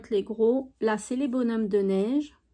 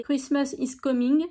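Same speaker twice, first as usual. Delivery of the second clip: English read with a French accent. Real, not acted. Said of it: "Avec mon accent".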